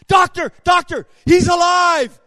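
high, loud urgent shout; imitating a frantic nurse like a movie; excited shout. Nurse announcing the father lives, high excited shout. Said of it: "just like in the science movie".

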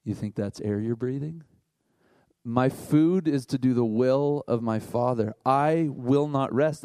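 hint of a calm Matrix mentor; movie mentor voice. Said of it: "he just leans in and goes".

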